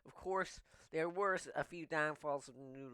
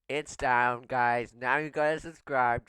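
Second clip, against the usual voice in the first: triumphant; 9 dB louder than usual. Real, not acted. After gulping noodles, triumphant.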